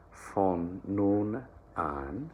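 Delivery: "von" begun with an unvoiced F, very slow. Shown as a demonstration. Coach showing the German F sound, very slow. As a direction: turn the V of "von" into an F. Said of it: "that V becomes an F".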